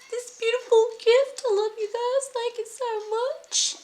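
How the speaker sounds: high, gushing thank-you voice. Real, not acted. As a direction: squeal your thanks. Gushing thanks to gift giver.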